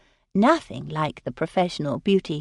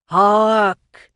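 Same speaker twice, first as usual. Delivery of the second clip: loud ringing cry of a fake medium; hammed-up prophetic shout; theatrical cry; 3 semitones higher, 8 dB louder than usual. A narrator's reading. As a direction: call it out to the whole room. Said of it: "he cried".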